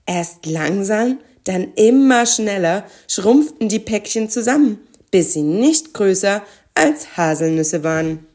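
ordinary delivery

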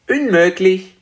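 high, firm dragon voice; entschlossener kleiner Drache. A narrator's reading. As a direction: rule it out firmly as a little dragon. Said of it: "sagte Spekulatius entschlossen". (hohe, entschlossene Drachenstimme)

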